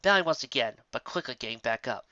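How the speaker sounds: usual speaking voice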